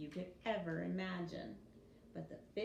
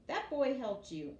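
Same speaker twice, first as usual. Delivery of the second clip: stern; 5 semitones higher, 7 dB louder than usual. Acted. King scolds daughter.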